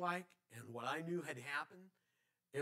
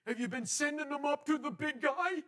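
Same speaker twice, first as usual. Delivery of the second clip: much higher and louder, imitating the nephew; nephew's voice; 11 semitones higher, 8 dB louder than usual. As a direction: put on the nephew's eager voice. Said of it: "he says".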